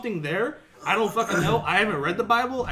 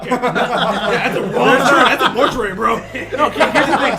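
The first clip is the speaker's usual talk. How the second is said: laughing; 3 semitones higher, 9 dB louder than usual. Real, not acted. Laughing at a wrong church.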